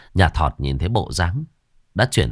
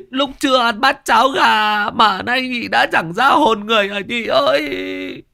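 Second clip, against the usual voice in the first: much higher, comic sobbing lament; hammed-up wailing. Thọt wails over his fainted friend, hammed-up high sobbing. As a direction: wail over your friend like a hysterical mourner. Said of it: "tiếng nhà Thọt nó khóc".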